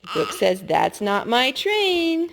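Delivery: put-on drawn-out bright read for a toddler; excited picture book voice; reading to children.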